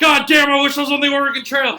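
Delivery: loud, high hammed-up lament; mock-agonized yelling; anguished soldier. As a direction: holler it like a suffering soldier. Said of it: "you hear him, he's like".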